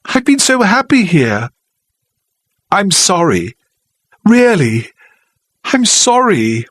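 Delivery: apologise, near tears; helpless. Acted. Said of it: "she said helplessly".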